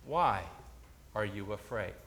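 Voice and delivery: low, soft question with pauses; quiet questioning. Acted. Preacher as Jesus asking disciples after the calm, quiet. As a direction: ask it softly into the stillness.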